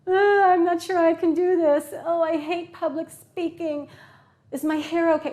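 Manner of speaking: high, whiny worrying; demons of self-doubt. Acted. Presenter's demons nagging before a speech, high fretful whine. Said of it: "the demons that say".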